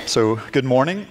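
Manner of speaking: ordinary talk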